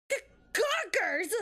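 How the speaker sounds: put-on high, stuttered, indignant old woman; Old Bag. Guard spotting gawkers, indignant old woman voice. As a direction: stammer it in a high old-biddy voice. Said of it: "Anyways, this is Old Bag".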